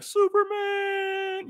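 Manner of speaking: long drawn-out anguished cry, way up high; desperate call out. Retold or imitated. Batman cries out his locked-in friend's name, long high wail.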